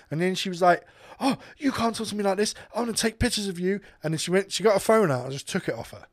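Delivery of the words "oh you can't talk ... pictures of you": lightly mocked, higher-pitched affronted voice; indignant customer. Customer threatening to photograph the man who insulted her, mock indignation. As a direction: imitate an offended customer's protest.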